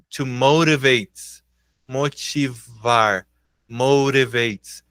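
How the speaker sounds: muito devagar, articulando; pronúncia lenta. Shown as a demonstration. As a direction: soletre o som com calma. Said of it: "professor demonstra pronúncia". (demonstração lenta da pronúncia)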